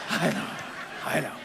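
real laughter through the words; laughing it off. Real, not acted. Laughing along as the supervisor lectures him. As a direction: chuckle while agreeing, playing along. I'm just playing along.

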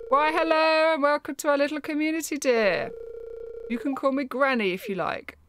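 chirpy grandmother greeting a newcomer; sweet granny. Acted. Reads Evelyn's granny dialogue.

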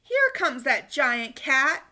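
loud, slow amused call; customer's greeting. A narrator's reading. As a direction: call out delighted.

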